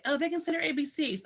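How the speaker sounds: imitated boasting parent. Parents boast to teacher about their child, imitated boasting. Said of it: "parents come to me and say".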